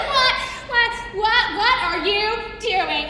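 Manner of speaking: can't stop laughing as you ask; giggling what, what, what are you doing; laughing disbelief. Retold or imitated. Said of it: "And I say".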